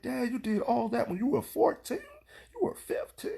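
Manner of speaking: high, hushed disbelief; shocked daughter. Retold or imitated. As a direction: imitate a stunned kid, pitch climbing to a near-whisper. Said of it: "they just be shocked".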